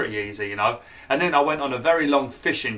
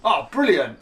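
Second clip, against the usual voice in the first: surprised; 7 semitones higher, 4 dB louder, slower than usual. Real, not acted. Sudden excited exclamation at horse.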